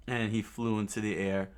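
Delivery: plain reading voice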